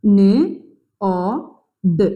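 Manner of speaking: ന്, ഓ, ദ് as three separate sounds; sounded out. Shown as a demonstration. Teacher spelling the word by sound, isolated ന്, ഓ, ദ്. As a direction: say ന്, ഓ, ദ് one at a time with clear pauses. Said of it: "സൗണ്ടോട് കൂടിയിട്ട് എഴുതാൻ".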